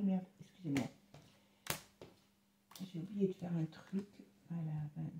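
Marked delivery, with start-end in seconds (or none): none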